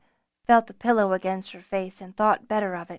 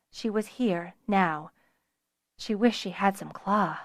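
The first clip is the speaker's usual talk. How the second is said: usual speaking voice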